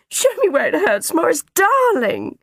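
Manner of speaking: very high, loud anxious doting; fussing mother. A narrator's reading. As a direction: coo loudly over a hurt child. Mother fussing over hurt son.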